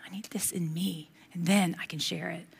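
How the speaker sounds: whispered to yourself, unvoiced. Shown as a demonstration. Pastor whispering the line to the congregation, hushed whisper. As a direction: breathe it like a private confession.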